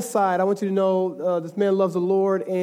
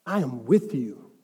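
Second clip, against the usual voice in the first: low, slow "I am with you"; solemn assurance; 7 semitones lower, slower than usual. Acted. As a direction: reassure deeply, stretching "with you". Voicing God's assurance to believers.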